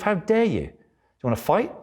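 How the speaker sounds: mock aggression. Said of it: "get aggressive and say".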